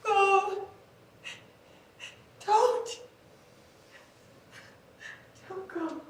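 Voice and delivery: fading plea. Acted. Lover's last soft plea to Bo, fading. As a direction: let the begging fade to nothing.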